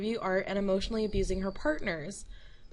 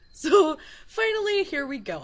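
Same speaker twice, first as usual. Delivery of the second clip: excited; 6 semitones higher, 7 dB louder than usual. Real, not acted. Announcing the main point.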